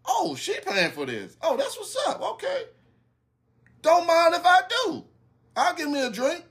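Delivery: high, laughing, pleased with the free drink; delighted inner voice. Retold or imitated. Man thinking she is paying for drinks, high amused inner voice. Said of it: "in my head, I'm sitting there thinking".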